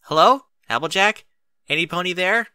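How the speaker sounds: calling through door. A narrator's reading. Twilight at the door, calling out loudly to Applejack. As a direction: call out to whoever is inside. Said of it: "Twilight knocked again and called".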